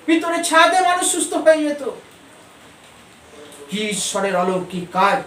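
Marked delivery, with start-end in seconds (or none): 0.1-1.8 rising preacher emphasis. Acted. Preacher repeats the line twice.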